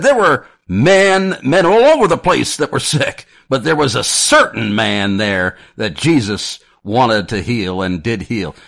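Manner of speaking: high, loud Texan pulpit impression, easing down; Texan Baptist sermon voice, loud then softening; Texan preacher accent. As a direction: imitate a Texan preacher starting at full volume, then settling. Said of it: "in his very thick, uh, Texan accent".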